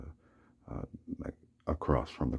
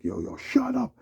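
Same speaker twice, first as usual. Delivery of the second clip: high, loud imitation of the neighbor yelling; mock shout; 5 dB louder, slower than usual. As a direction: bark it back at him high and loud, mocking. Retelling the neighbor's taunt.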